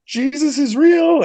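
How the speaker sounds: high, loud, slow burst of conviction; excited realisation. Retold or imitated. Convert telling himself Jesus is real, exultant. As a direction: cry it out like a sudden revelation. Realising faith after documentary.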